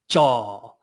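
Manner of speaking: jaw, vowel held long; drawn-out jaw. Shown as a demonstration. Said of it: "drawing out the syllable".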